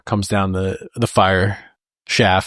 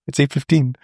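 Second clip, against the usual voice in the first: offhand, higher and casual; nonchalant; 6 semitones higher than usual. Retold or imitated. Sensei answering Bob's panic, lightly nonchalant. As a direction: toss off the time without a care. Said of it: "He's just nonchalant".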